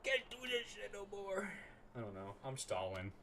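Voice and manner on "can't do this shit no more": put-on soft, higher tired old voice; weary old man. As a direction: sigh it out like a worn-out old man. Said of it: "He's gonna be like".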